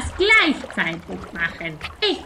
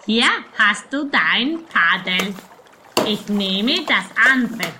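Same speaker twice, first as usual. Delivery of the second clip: ordinary talk, nothing marked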